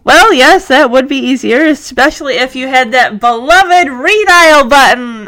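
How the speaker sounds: usual speaking voice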